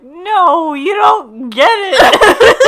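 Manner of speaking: high, loud whiny laughing wail; hammed-up whiny cry through laughter; whiny Ross. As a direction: wail it like a loopy drunk. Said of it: "He was so whiny".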